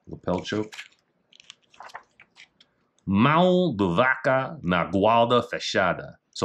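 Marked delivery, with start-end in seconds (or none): 3.1-6.2 Portuguese reading. Shown as a demonstration. Reading Portuguese technique name.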